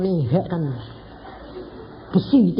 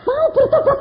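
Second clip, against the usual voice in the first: much higher voice asking husband; wife's high voice; 4 dB louder than usual. Retold or imitated. Wife asking her husband if he'll repent. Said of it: "Langsung dia bilang".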